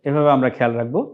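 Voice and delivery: ordinary talk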